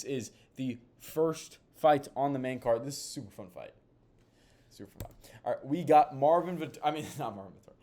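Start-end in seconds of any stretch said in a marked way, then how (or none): 4.7-5.3 whisper. Acted. Repeats line, then whispers it.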